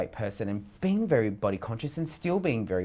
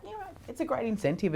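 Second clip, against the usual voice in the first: much higher, quick, chirpy self-quote; high mock self-quote; 4 dB quieter, faster than usual. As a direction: pitch way up to imitate your upbeat self. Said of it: "And I was like".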